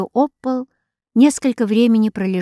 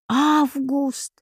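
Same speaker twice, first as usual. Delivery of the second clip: higher, a touch of fear, pleading; frightened plaintive cry; 6 semitones higher than usual. A narrator's reading. Доротея, frightened by her brother, plaintive higher cry. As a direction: call his name in alarm. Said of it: "в испуге вскричала Доротея жалобным, умоляющим голосом".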